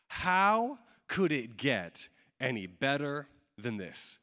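drawn out word by word, dropping away at the end; slow wondering question. Acted. Preacher voicing a disciple's question to himself, slow and deliberate. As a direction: let each word hang, sink on the last.

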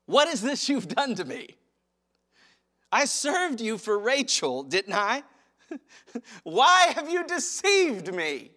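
hammed-up indignant Jacob; Jacob's outrage. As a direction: play the betrayed groom, aghast. Acting out Jacob confronting Laban.

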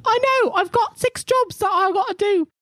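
put-on very high, excited kid voice; squeaky little boy, thrilled; high cheeky child voice. GM voicing Pip the boy.

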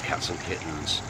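ordinary delivery

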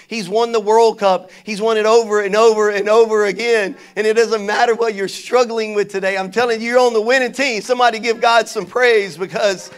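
loud, high, rousing sermon climax; full-force preaching. Real, not acted. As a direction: preach it to the crowd, building to a call for praise.

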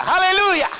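high, loud hallelujah cry; preacher's shout. Real, not acted. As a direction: lift the church with a ringing hallelujah. Preacher's exclamation to congregation. (grito de aleluya, alto y fuerte)

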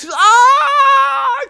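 very high, held scream; high-pitched acted howl of pain; cartoon pain scream. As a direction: shriek as if poked in the eye. Kevin is hit in the eye.

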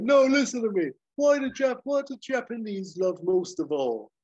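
high, loud eager insistence; hammed-up know-it-all; Hawk insisting. Hawk lecturing his friends, high eager insistence. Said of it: "And Hawk's like".

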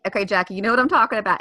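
ordinary delivery

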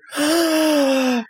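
high, theatrical gasp; awed gasp. Acted. Onlooker amazed by the rescuer, hammed-up gasp. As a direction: gasp like an adoring fan. Admiring crowd in the game.

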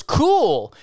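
high, falling mock-dismissive "cool"; dismissive reply. Guide brushing off his turtle sighting, mock-dismissive. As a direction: imitate a condescending brush-off. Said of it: "it was the way he said it".

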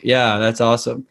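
level, unvaried monotone delivery. Shown as a demonstration. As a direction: say it dead level without melody. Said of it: "I was monotone".

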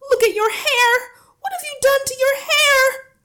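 piercing, very high screamed questions; =shocked wife at full shriek; horrified shriek. A narrator's reading. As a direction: scream in horror at your husband. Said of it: "the mother was shrieking".

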